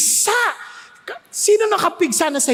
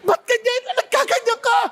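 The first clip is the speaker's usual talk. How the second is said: crying out; 8 semitones higher than usual. Retold or imitated. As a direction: wail at what happened to him. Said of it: "Iyak sila".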